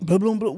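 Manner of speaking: loud, quick booming noises; imitated thudding. Storyteller repeating the noise they heard, imitated thudding. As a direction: act out the crash with the voice.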